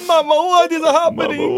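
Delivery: high, loud, slow mock-crying baby; Italian baby. Playing a little Italian baby to Mama, hammed-up wail. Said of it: "Little Italian baby".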